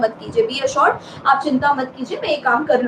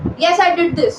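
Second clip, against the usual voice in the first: blunt admission; 4 semitones higher, much slower than usual. Shown as a demonstration. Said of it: "ऐसे हम offices में नहीं बोल सकते".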